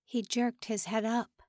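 usual speaking voice

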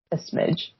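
soft unvoiced whisper. Real, not acted. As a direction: whisper the word as an aside. Whispered repeat.

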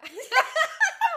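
squeaky burst of laughter; shrieking giggle; high squealing laugh. Real, not acted. Laugh after voice crack.

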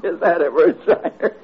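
high, breathless laughter; laughing. Acted. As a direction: burst out laughing at him. Herb bursts out laughing at eye.

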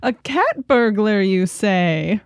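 slow, drawn-out theatrical curiosity; mock intrigue. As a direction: play an intrigued detective, hammed up. Plays along with cat burglar joke.

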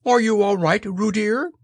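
put-on high-pitched worried call to a child; anxious mother calling. A narrator's reading. Kanga to her son in the water, anxious. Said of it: "called Kanga anxiously".

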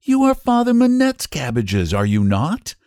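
high, careful little question; courteous query from a small boy; polite inquiry. A narrator's reading. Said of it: "he inquired politely".

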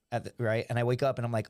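ordinary delivery

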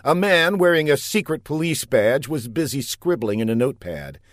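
ordinary delivery, nothing marked

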